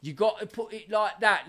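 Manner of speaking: ordinary talk, nothing marked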